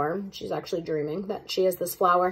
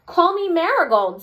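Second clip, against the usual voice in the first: eager; 8 semitones higher, 4 dB louder than usual. Acted. Jo eagerly picks flower name.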